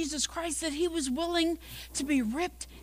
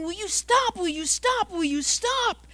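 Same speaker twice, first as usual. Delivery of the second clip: a mock yell, repeated in protest; 7 semitones higher than usual. Teacup on the potter's wheel, mock yelling. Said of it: "I'm yelling".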